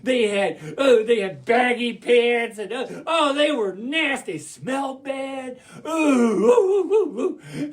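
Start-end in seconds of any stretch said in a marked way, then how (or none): 5.9-7.7 high, loud string of oohs; hammed-up shudder of revulsion; disgusted oohs. Storyteller reacting to smelly wolves, disgusted oohs.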